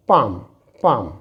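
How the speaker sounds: पाम said as pam, no l; silent l demo. Shown as a demonstration. Teacher showing students the silent l in पाम. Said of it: "तो इसे हम पढ़ते हैं".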